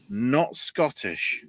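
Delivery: ordinary talk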